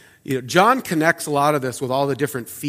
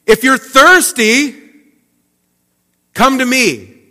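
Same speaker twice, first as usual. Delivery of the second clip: much higher and louder, slow proclamation; slow, loud summons; loud call; 9 semitones higher, 7 dB louder, slower than usual. Retold or imitated. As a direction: cry it out over the festival crowd. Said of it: "says loudly".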